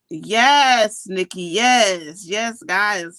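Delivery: loud, drawn-out happy yes; joyful yes. Real, not acted. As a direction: cry out yes with joy. Joyful affirmation to viewers.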